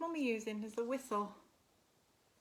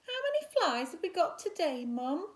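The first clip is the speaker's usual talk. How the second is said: high, quick small-spider voice; eager little spider asking Mum; 7 semitones higher, 7 dB louder than usual. Acted. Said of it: "asked Spinderella".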